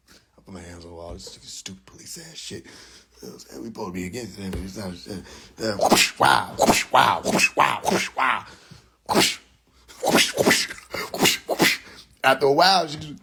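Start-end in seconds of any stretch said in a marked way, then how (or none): 0.1-5.8 very soft, low grumble; hushed, low-pitched griping; muttered complaint. Retold or imitated. Muttered complaint while obeying his mother. 5.9-11.8 breathy whoosh of a swinging belt; repeated whoosh-and-smack sound effects; imitated whipping.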